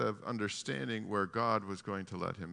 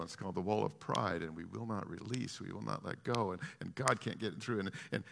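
usual speaking voice

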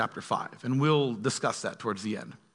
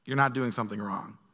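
ordinary talk, nothing marked

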